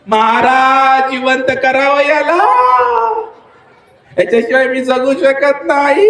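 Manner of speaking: high, rising mock wail; theatrical widow's keening, words stretched out; wife's crying. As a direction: plead tearfully in a hammed-up singsong. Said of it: "तू रडायला लागली माहिती".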